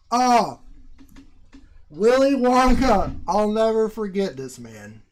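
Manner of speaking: loud, high, slow exclamation; overjoyed thanks to a donor; excited. Real, not acted. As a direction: cry out with gratitude.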